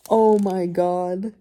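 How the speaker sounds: very loud, drawn-out exclamation; loud, stretched-out dismay; shocked outcry. Real, not acted. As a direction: cry out slowly in real disbelief. Reeling from shocking book twist.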